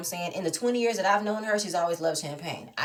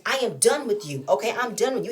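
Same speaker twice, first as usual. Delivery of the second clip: ordinary delivery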